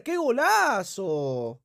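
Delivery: slow, low, drawn-out praise; slow drawn-out awe. Acted. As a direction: savour every syllable. Celebra el gol del juego. (asombro lento y alargado)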